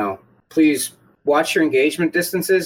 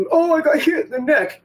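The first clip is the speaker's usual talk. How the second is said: high-pitched, whiny mock complaint; mock over-exaggeration; 10 semitones higher than usual. Player mocking a snowflake complaining of a neck hit, exaggerated high voice. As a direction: ham up a delicate victim's complaint. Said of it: "some kind of cuck snowflake over-exaggeration".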